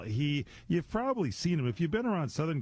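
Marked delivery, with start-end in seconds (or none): none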